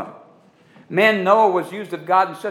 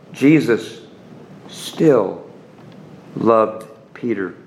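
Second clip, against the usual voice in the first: each word set apart, lower and louder, slowing on loved Peter; word-by-word emphasis; 8 semitones lower, 4 dB louder, much slower than usual. Acted. Preacher assuring the crowd, each word spaced and lower. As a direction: spell out every word with weight.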